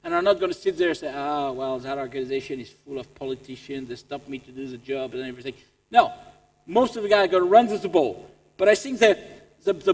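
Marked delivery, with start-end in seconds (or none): 1.2-5.5 low, soft, resigned grumble; mock defeatist moan, low and quiet; grumbling excuse-maker. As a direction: complain wearily under your breath. Acts out a complaining non-doer.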